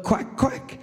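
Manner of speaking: high, voiceless mock quacking; duck quack imitation. Buddy clarifies the animal with a high quack imitation. Acts out the duck's call.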